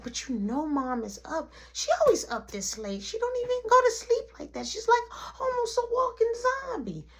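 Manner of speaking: put-on high-pitched childish protest; imitating an insistent small kid; whiny little boy.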